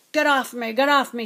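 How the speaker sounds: loud, protesting little gnome; small creature's loud squawk; squeaking gnome. A narrator's reading. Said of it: "squeaked the gnome".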